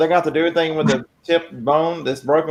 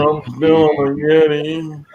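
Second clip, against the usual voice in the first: stoned voice; 2 semitones higher than usual. Retold or imitated. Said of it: "you sound like you're just stoned".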